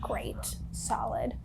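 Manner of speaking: whispered dry reaction. Real, not acted. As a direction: barely breathe the words, deadpan. Player reacting to horror quietly.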